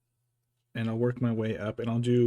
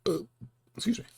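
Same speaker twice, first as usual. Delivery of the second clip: stifled burp after eating; 10 semitones higher, much faster than usual. Real, not acted. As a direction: excuse a small burp. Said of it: "I ate too fast".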